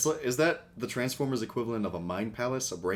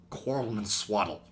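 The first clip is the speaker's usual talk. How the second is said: whisper imitation. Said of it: "the sound that he makes when he whispers".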